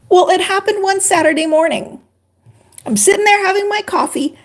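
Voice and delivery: ordinary delivery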